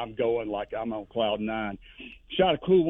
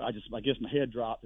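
ordinary delivery

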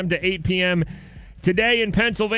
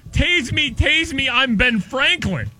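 mock crazed suspect; 8 semitones higher, 4 dB louder than usual. Repeating the suspect's quote again.

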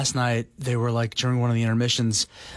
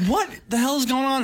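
surprised; 12 semitones higher than usual. Retold or imitated. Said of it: "I was like".

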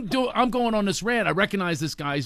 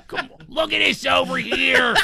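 put-on wicked Philly accent; 7 semitones higher than usual. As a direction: stretch it out in broad Philadelphia speech. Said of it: "Imagine him from Philadelphia as a Philadelphia".

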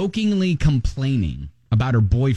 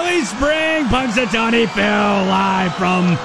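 excited shouting; slower than usual. Acted. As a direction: whoop it up like a crowd. Celebrating early spring.

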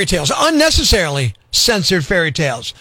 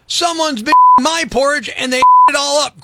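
higher, louder little bear cry; baby bear crying out; 7 semitones higher, 4 dB louder than usual. Acted. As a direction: cry it out like a small bear cub. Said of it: "cried the baby bear".

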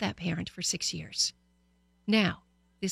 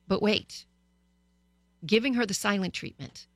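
ordinary delivery

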